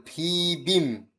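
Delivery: "ভি বিম" slowly, aspirated bh then plain b; pronunciation demo. Shown as a demonstration. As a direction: stretch "ভি", then land on "বিম". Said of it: "মানে ভ হবে".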